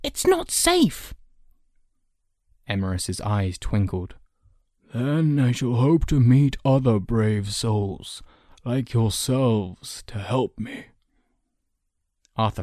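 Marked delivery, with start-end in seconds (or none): 0.0-1.1 put-on high, slow boyish warning; concerned boy. Boy warning the old man about travelling, concerned. 4.9-10.9 slow elder's voice; twinkling old man. Acted. Old man reassuring his young rescuers, twinkling.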